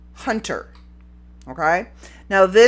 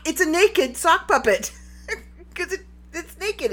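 giggling; 10 semitones higher than usual. Real, not acted. Giggling over her joke.

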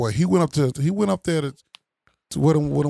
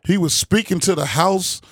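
ordinary talk, nothing marked

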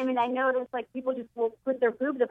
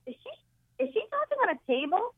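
much higher, puzzled voice; Carlos confused; 9 semitones higher than usual. Retold or imitated. Carlos asking if the sister is talking on a table, high and puzzled. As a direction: play baffled Carlos asking. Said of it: "Carlos came by and he goes".

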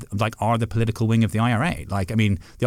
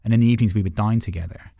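ordinary talk

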